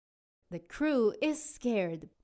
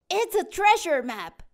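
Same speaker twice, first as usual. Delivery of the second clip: excited captain; 8 semitones higher, 7 dB louder than usual. Acted. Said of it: "he says".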